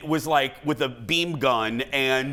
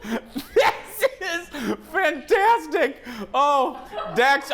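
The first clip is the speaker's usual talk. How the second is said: laughing; 11 semitones higher, much slower than usual. Real, not acted. Laughing at glitching video call.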